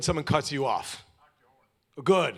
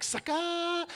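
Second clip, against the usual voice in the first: triumphant taunt. Retold or imitated. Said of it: "I'm like".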